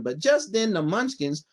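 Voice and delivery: ordinary delivery, nothing marked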